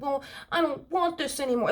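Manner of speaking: high-pitched whiny child imitation; talking like a kid. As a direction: whine it like a sulky kid. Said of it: "they talk like a kid".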